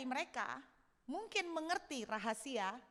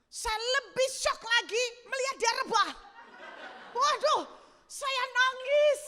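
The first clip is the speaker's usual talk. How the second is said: much higher and louder, laughing through tears of joy; overjoyed, laughing retelling; 8 semitones higher, 6 dB louder than usual. Retold or imitated. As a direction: gush with joy as if it's happening again. Reliving her joy at husband falling. (sangat gembira sambil tertawa haru, suara jauh lebih tinggi)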